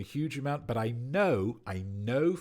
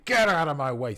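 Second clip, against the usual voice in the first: grumpy; 5 semitones higher, 8 dB louder than usual. Acted. Voicing grumpy Obliteratus.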